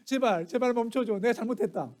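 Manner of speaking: hammed-up plea to stop; mock pleading. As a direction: beg for mercy through a locked door. Pastor acts begging daughters through door.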